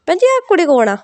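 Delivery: put-on mother-in-law asks the bride's mother about the girl, curious and high. (ऊँची, जिज्ञासु पंजाबी सास की आवाज़)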